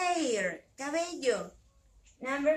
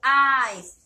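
"Eyes" called out loud for the class to echo; "Eyes" said loud and clear, leading the kids; loud model word; 3 semitones lower, 10 dB louder than usual. Shown as a demonstration. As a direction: belt out "Eyes" as a cue to repeat. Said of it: "Repeat after me".

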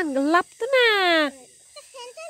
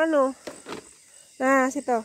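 ordinary talk, nothing marked

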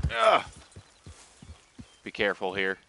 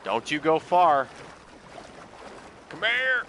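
calling out; 6 semitones higher, 3 dB louder, slower than usual. Real, not acted. Calling to the wandering horse.

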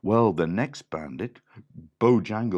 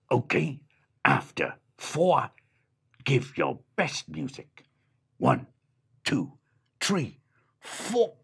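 dog leader counting in, higher; dog counting in the band; 6 semitones higher, 4 dB louder than usual. A narrator's reading. Dog to the animals, counting them in loudly. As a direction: call the count like a bandleader. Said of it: "and the dog said".

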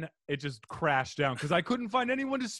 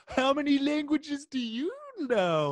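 high mock customer question; 10 semitones higher than usual. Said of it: "they're like".

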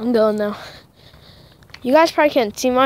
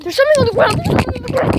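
high, loud alarmed cry; frightened alarm; 12 semitones higher, 4 dB louder, faster than usual. Real, not acted. Spots something scary.

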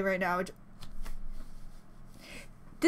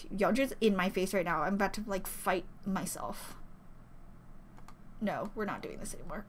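low, very soft grumble; quiet, sinking voice; overwhelmed muttering; 5 semitones lower, 12 dB quieter than usual. Real, not acted. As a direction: mutter it under your breath, overwhelmed. Overwhelmed, muttering while composing herself.

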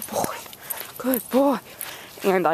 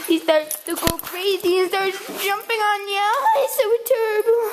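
very high, mock-wailing whine; mock distress; 14 semitones higher than usual. Owner moaning about the dog jumping on people, mock distress. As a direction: wail it in a silly high voice, playing at despair.

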